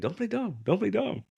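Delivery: high, hushed insistent repeat; imitated accusation. Customer accusing the shopkeeper, imitated high and insistent.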